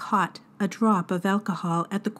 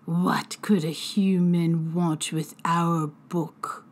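slow threatening hiss of a fairy; drunken fairy whispering a deadly threat; slow menacing whisper; 4 semitones lower, slower than usual. Acted. Fairy healer voice.

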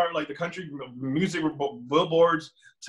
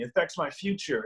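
ordinary delivery

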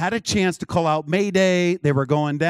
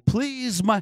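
higher-pitched retold cry for help; imitated distraught plea; 7 semitones higher than usual. Desperate woman phoning a prophet, mock pleading. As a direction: plead urgently, without real tears. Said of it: "hear her screaming and crying".

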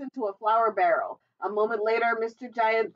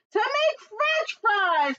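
high, drawn-out happy shout; excited cry; 12 semitones higher, 3 dB louder, much slower than usual. A narrator's reading. Jack explaining why to plant potatoes, excited cry. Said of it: "cried Jack".

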